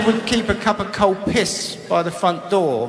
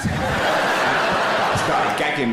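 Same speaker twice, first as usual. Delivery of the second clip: laughing; 3 semitones lower, much slower than usual. Real, not acted. Describing gagging, laughing.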